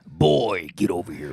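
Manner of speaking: Kratos voice. Retold or imitated. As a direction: growl it like the god of war. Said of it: "I am Kratos".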